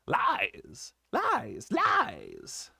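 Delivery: lightly voiced, hoarse hidden goblin; mock the child from the rocks. A narrator's reading. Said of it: "a hoarse, indistinct voice".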